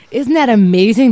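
high, loud gushing wonder; re-enacted giddy awe; stoned amazement. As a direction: gush as if utterly amazed. Acting out her stoned amazement.

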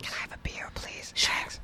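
whispered aside. Real, not acted. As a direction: hush it off-mic. Aside request for beer.

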